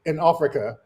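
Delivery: lightly mocking British accent. Mocking the man's talk about Africa, mock British accent. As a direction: imitate him with a hint of British. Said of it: "got a British accent talking about".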